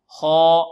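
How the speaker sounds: "خاء" loud, long and held on one note; heavy kha drill. Shown as a demonstration. Teacher leading repetition, loud sustained heavy kha. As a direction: chant "خاء" thick, lips unrounded. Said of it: "انطقوا معي".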